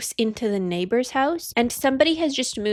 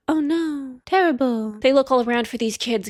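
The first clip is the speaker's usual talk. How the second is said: usual speaking voice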